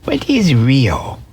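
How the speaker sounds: high, timid little rabbit; wide-eyed velveteen rabbit asking; small rabbit voice. Acted. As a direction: ask it like a shy toy rabbit. Rabbit voice, higher.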